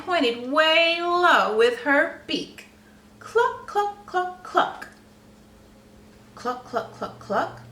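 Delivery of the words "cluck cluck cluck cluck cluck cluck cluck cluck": hen clucks. Acted. Hen clucks pointing under log.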